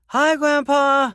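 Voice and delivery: put-on high, slow little-girl shout; a small girl calling out to her grandpa; child shouting. A narrator's reading. Little girl shouting hello to her grandpa, high and drawn out.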